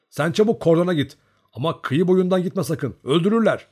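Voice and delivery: high, loud, insistent orders; urgent warning. A narrator's reading. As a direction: shout them back from danger.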